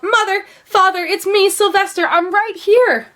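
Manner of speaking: high, loud desperate calling out; silent shout. A narrator's reading. Son calling to his parents on the hill, high loud cry. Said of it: "How he wanted to shout".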